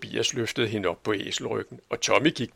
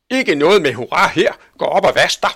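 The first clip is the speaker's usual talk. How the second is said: loud, higher sharp rebuke; scolding aunt; 6 semitones higher, 10 dB louder than usual. Acted. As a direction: cut off the cheering sternly. Tante Sofie irettesætter barnet. (skarp, høj irettesættelse)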